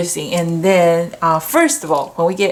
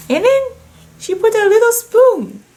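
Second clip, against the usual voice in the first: much higher, louder giddy delight; thrilled; 14 semitones higher, 4 dB louder than usual. Real, not acted. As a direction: squeal with joy over the little spoon. Thrilled by tiny spoon on card.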